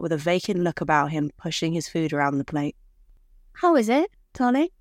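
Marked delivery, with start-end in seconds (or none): 3.5-4.7 high, bright hopeful question; sweet wife voice. Acted. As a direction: ask sweetly, hoping he likes it. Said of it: "she asked".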